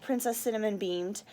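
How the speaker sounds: plain reading voice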